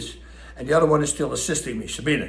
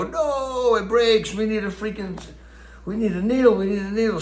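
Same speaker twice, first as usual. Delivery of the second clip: higher, urgent cry as the needle snaps; a hammed-up panic over needing a needle; replaying his own frantic reaction, pitched up; frustrated alarm; 7 semitones higher than usual. Needle breaks mid-stitching.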